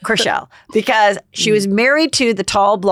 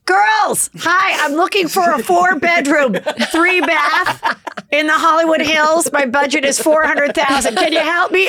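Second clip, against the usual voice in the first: hammed-up naive client; mock client voice; 9 semitones higher than usual. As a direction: play the naive buyer asking the realtors. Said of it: "And I got in there and I go".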